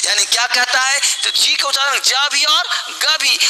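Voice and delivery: normal talking voice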